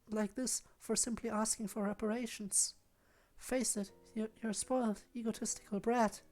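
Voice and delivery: quiet icy contempt, lightly voiced; a hint of a cold, haughty girl. Voices Yuri in argument.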